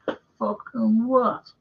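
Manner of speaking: high, slow, disbelieving "you what"; imitating his own stunned comeback; indignant retort. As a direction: stretch it out in mock outrage. Retelling confronting the Scottish official.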